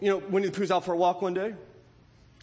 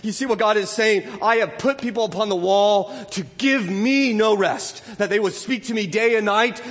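loud, higher, driving sermon; full-force preaching; 4 semitones higher, 7 dB louder than usual. Acted. Pastor drives home relentless prayer, loud preaching. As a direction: preach it hard to the congregation.

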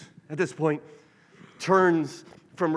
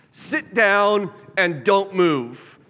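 slow, stern, louder order to sit down; firm, slow, raised parental command; scolding a child; 3 semitones higher, 6 dB louder, much slower than usual. Retold or imitated. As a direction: lay down the law word by word, like to a child. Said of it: "how Jesus talks to hurricanes".

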